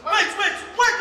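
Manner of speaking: loud, high insistence; angry interruption. Acted. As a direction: cut them all off. Wife cutting everyone off.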